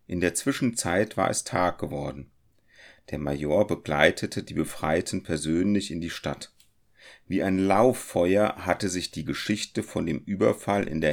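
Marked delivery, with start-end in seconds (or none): none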